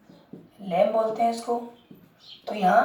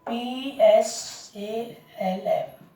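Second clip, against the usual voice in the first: spelling it out slowly; 3 dB quieter, much slower than usual. Shown as a demonstration. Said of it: "ध्यान देना".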